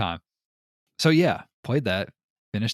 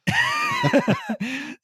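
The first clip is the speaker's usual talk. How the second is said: high burst of laughter; real laughter; loud laugh; 3 dB louder than usual. Real, not acted. Laughing at the story.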